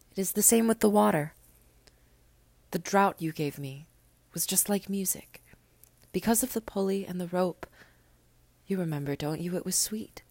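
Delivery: a hint of a small boy, gentle and certain; little prince. Little prince tells the pilot to wait for him, lightly voiced young boy.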